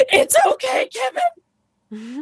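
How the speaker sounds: high, fluttery fretting owner voice; anxious owner. Retold or imitated. Owner in the corner fretting at the pig, high anxious coo. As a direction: coo it like a nervous pet owner. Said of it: "that's not calming".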